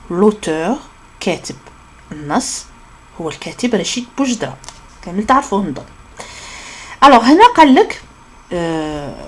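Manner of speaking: ordinary talk, nothing marked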